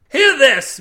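high, loud demand to be heard; commanding call. A narrator's reading. Milby to Cade before his tale, calling out. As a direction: seize the crew's attention.

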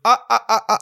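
high fake embarrassed laugh; mock laugh. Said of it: "Dann sag ich". (hohes gespieltes Lachen)

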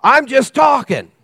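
high, very loud exasperated shout; frustrated yelling. Acted. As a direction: shout it back in frustrated self-defence. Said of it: "you get frustrated and go".

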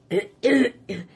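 clears her throat hard; rough throat clear. Real, not acted. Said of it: "I'm losing my voice".

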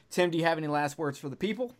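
normal talking voice

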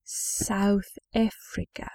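South Africa said slowly and very quietly in a mock South African accent; half-hearted South African accent, part Australian, part British; bad South African accent. Shown as a demonstration.

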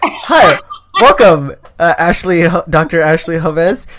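loud, bright show-host greeting; announcer welcome. Acted. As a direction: boom out the guest's name like a host. Host welcomes guest on podcast.

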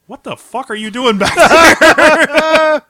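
high, loud, drawn-out imitation of an outraged wife; hammed-up slow, high holler; mock outraged yell. Wife catches the bed shaking, mock outraged yell. Wife's startled demand in retold story.

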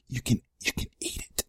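fast taunting whisper. Acted. Whispering a taunt at the haters. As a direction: hiss it gleefully.

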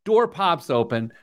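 ordinary delivery

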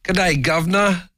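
high, put-on British accent; cheery cockney greeting. Shown as a demonstration. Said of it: "Let's hear that".

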